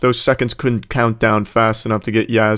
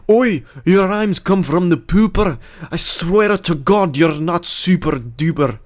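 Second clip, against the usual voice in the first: high, slow, hammed-up Scottish rap; bad Scottish accent; 6 semitones higher, slower than usual. Mocking the battle rappers' rhymes, badly faked Scottish accent. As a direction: attempt an Irish rapper and land on Scottish. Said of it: "I guess that's more Scottish".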